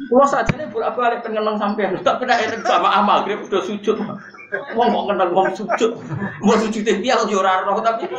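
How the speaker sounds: lightly acted confession, high and amused; mock confession. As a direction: confess it high-pitched, with a laugh creeping in. (pura-pura ngaku karo bojo, suara dhuwur karo ngguyu)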